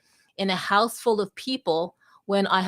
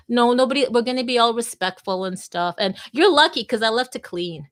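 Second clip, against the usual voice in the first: ordinary talk, nothing marked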